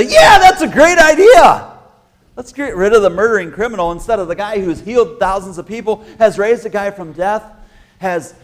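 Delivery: high, loud sarcastic mockery with a laugh; mocking sarcasm. Mocking crowd choosing the murderer, loud sarcastic jeer. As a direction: sneer at the idea, laughing.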